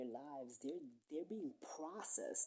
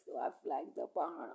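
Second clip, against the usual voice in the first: ordinary talk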